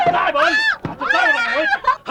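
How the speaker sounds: enraged brother shouting; furious shout. Acted. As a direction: scream the threat at her. Man threatens woman.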